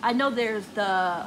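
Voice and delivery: normal talking voice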